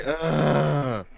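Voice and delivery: exaggerated ugh. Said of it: "Make him say".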